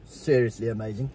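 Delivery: sarcastic. Real, not acted. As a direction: say it with a dry sarcastic edge. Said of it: "It sounds so sarcastic when you say that".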